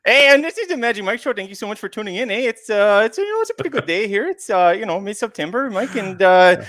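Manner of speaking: bad put-on Canadian accent, high and bouncy; terrible Canadian accent. Cohost greets the show in a joke Canadian accent. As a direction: ham up a fake Canadian accent. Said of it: "That is a terrible accent".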